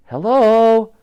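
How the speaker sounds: put-on high, loud girl's call into the dark. A narrator's reading. Girl calling into a dark clock tower, high and loud. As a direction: call out into the empty tower room. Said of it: "called out Elsie".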